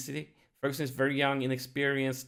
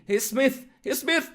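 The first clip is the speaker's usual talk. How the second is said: high, loud rising calls; urgent excitement; 12 semitones higher, 6 dB louder than usual. Real, not acted. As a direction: call the striker's name with climbing urgency. Counterattack reaches the striker.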